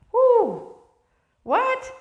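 high, loud whoop of disbelief; mock shock. Listener balking at Paul's words, acted high astonishment.

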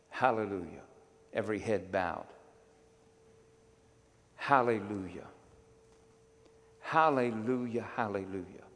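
fervent prayer leading over music, swelling at times; worship prayer. Acted. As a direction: pray aloud over the band, rising on the cries.

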